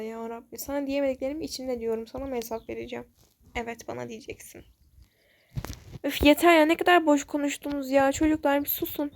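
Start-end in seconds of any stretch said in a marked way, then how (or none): 6.0-9.1 higher, louder "Üf, yeter ya" sliding down; girl losing patience with the bickering kids; fed-up outburst. Acted. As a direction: cut them off, sick of the chatter. Girl snaps at the quarreling kids.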